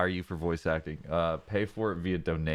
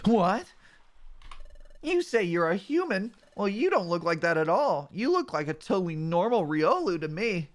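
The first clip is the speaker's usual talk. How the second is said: raised, incredulous buddy voice; surprised partner Mudkip; 11 semitones higher than usual. Acted. Mudkip partner doubting the Riolu was human, raised and incredulous. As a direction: sound baffled but friendly.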